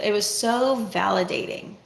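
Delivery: ordinary delivery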